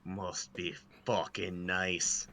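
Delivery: slow, soft, bitter jealous mutter; jealous grumble. Acted. Mercenary eyeing someone's claymore, slow jealous grumble. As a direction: drag it out with envy. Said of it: "I say, ... raging jealousy".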